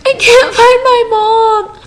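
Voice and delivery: high, slow, tearful wail; retold crying. Lost girl telling strangers she can't find her mom, high tearful crying. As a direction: replay the crying plea, voice breaking. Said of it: "I was just, like, in tears".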